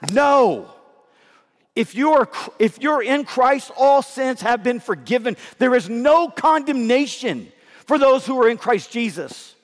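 rising fervent sermon voice; preaching with force. Real, not acted. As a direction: push back hard against the lie. Preaching against the enemy's lie.

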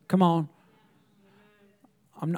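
ordinary delivery

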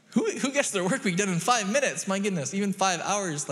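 high, loud comic disbelief; incredulous. Real, not acted. Preacher ranting about a five-minute work week, incredulous. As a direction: scoff in amazement at the absurd claim.

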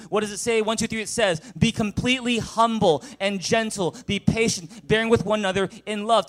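loud, high scripture read to the room; full-force preaching. Real, not acted. Pastor leading the congregation in loud reading.

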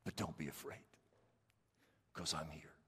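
soft, low comforting voice; calm them gently; tender reassurance. Retold or imitated. Preacher voices Jesus calming the believer, soft and low.

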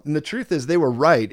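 normal talking voice

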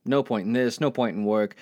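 normal talking voice

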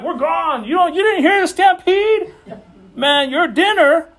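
high, loud, incredulous taunt at a straggler; mock incredulous herd. Fleeing herd to the straggler at the water hole, mock incredulous. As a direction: call it out high, stretching the last words.

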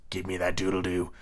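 low, soft villain voice; quiet menacing captain's demand; Hook. Shown as a demonstration. Hook demanding the doodle-doo, low and steely. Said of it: "in the proper Hook voice".

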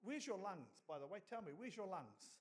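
normal talking voice

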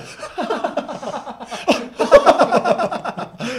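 big laugh, high and loud; high hearty chortle. Real, not acted. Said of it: "It was a chortle".

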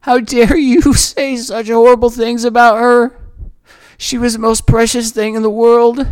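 how dare you, flung back at the cruel neighbors; furious retort. Retold or imitated. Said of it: "I was like".

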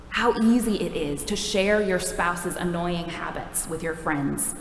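ordinary talk

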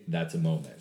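normal talking voice

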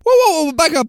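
high, loud startled interjection; excited protest. Real, not acted. Stopping his friend's wild claim, high and loud. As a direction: cut him off in disbelief.

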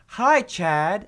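put-on high, bright little-kid greeting; toddler voice. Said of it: "she's like".

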